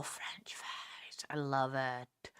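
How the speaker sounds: breathy whispered aside, slow. Real, not acted. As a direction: whisper it like a secret craving. Whispered aside about French fries.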